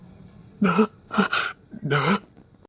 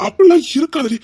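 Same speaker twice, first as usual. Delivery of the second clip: much higher and louder denial; emphatic protest; 10 semitones higher, 7 dB louder than usual. Acted. Man loudly reassuring his anxious lover, emphatic protest. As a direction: cry out to dismiss her fear.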